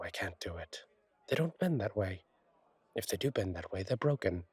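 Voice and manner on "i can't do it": unvoiced whisper, half to yourself; whispered aside. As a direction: whisper it like a confession. Whispered aside about wings.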